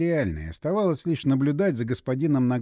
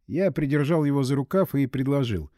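ordinary delivery

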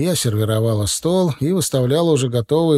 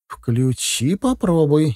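mildly impatient urging; a touch of eager insistence; impatient demand; 4 semitones higher, much slower than usual. A narrator's reading. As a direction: urge it eagerly, pitch jumping on the second word. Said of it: "нетерпеливо потребовала Инна".

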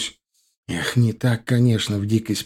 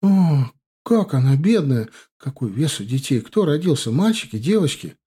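higher-pitched flurry of exclamations; excited wife; 4 semitones higher than usual. Acted. Wife reacting to news of twins, excited. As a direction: react with sympathy, then eager questions.

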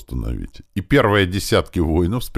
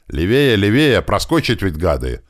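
urgent, raised, annoyed command; angry impatience; 4 semitones higher, 4 dB louder than usual. A narrator's reading. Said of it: "злился на такое долгое". (сердито, с нетерпением)

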